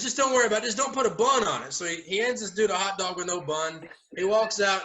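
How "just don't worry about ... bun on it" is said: dismissive. Retold or imitated. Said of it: "And he goes".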